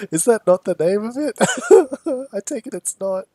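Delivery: asking through laughter; giggling high question; laughing. Real, not acted. Laughing at own made-up name.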